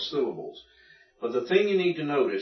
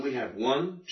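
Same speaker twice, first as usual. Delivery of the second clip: ordinary delivery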